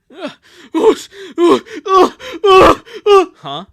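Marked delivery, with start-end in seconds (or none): 0.7-3.2 very loud, high exertion grunts; punching grunts. Acted. Sister hitting her boxing bag, explosive effort grunts. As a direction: grunt hard with every punch.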